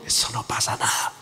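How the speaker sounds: soft, sly whispered temptation; tempting whisper. Retold or imitated. Satan whispers to Eve to tempt her, hushed. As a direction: whisper it like the serpent luring Eve.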